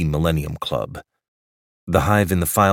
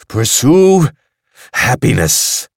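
much higher, loud triumphant cry; shout; 6 semitones higher, 9 dB louder, slower than usual. A narrator's reading. Quick sending the freed drones off, shouting. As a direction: fling it up to the ceiling. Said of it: "shouted Quick".

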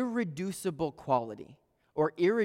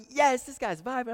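high-pitched, hammed-up thrill; excited; 9 semitones higher, 4 dB louder than usual. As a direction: squeal it like an overexcited fan of what was said. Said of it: "I was so excited".

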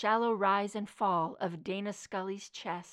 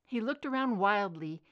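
plain reading voice

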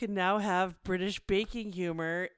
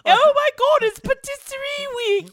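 put-on much higher and louder, squealing British accent; excited British baker; 15 dB louder than usual. Friends parody the baking show, squealing excited British voice. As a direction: gush like a thrilled bake-off contestant. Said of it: "mocking British baking show".

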